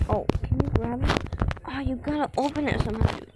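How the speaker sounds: ordinary talk, nothing marked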